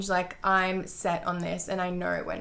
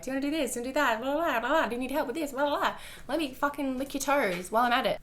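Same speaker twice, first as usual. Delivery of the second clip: higher, sing-song overeager voice; mock eager girlfriend; 6 semitones higher, faster than usual. Mocking how she kept pestering him, high overeager sing-song. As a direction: parody yourself trying way too hard. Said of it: "I was, like, asking all the time".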